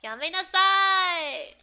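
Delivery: high, loud, slow anime shout; acted anime command. Shouting the anime line at the listener. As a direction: voice the character yelling stop.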